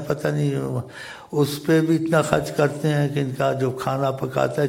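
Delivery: usual speaking voice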